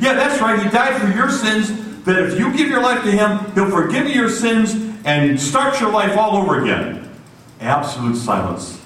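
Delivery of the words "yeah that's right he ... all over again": higher, eager and sure; lightly voiced eager [name]. [name] explaining salvation to the worker, eager. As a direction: answer eagerly.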